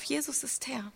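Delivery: breathy whisper, almost no voice; whispered confession. Acted. Preacher to congregation, confessing Jesus as Lord, whispered. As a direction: whisper it like a secret creed. Confession of faith at sermon's heart.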